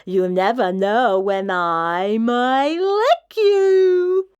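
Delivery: put-on trying to sound terrifying to scare the kid; mock terrifying monster. A narrator's reading. The monster Mac tries to sound terrifying, slow and loud. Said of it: "trying to sound terrifying".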